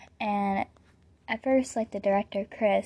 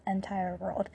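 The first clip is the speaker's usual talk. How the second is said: whispered; 2 semitones lower, 10 dB quieter than usual. Real, not acted. Trailing whisper about beloved kids.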